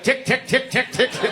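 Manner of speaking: high, light "tick, tick, tick"; ruler knocking. Retold or imitated. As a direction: tap out the knocks with the voice. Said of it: "I actually knocked with the ruler".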